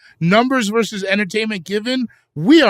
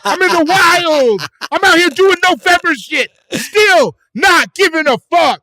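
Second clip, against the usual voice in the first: high, loud shouted declaration; over-the-top full-voice hollering; wild-man holler; 11 semitones higher, 6 dB louder than usual. Acted.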